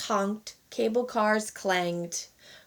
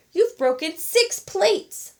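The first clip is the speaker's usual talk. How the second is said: sniffling; 9 semitones higher, 3 dB louder, slower than usual. A narrator's reading. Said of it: "Papa said with sniffle".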